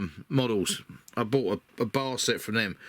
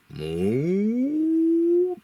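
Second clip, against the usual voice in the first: cow moo; 13 semitones higher, 5 dB louder than usual. Pretend. Imitating a cow after "Cow.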